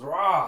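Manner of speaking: loud open roar; monster roar. Acted. Possessed monster grabbing Yafaela by the throat, roar. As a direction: roar out as an attacking beast.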